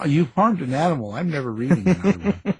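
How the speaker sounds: high, fast, laughing mock complaint; mock indignation. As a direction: play an outraged reader, breaking into laughter. Jokingly mimics an offended reader.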